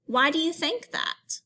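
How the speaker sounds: normal talking voice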